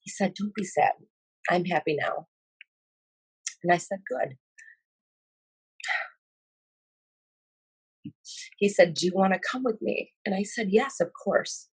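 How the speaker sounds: halting, on the edge of tears; choked up. Real, not acted. Witness recalling the boy's invitation, choked up. Resumes painful retelling after saying sorry.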